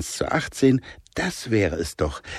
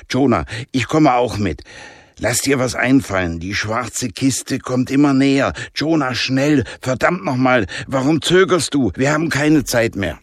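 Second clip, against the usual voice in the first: put-on Jannik, a pressing boy, raised pitch; urgent boy; 5 semitones higher than usual. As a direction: hurry him along, getting impatient. Jannik drängt. (Jannik treibt Jonah an, dringlich)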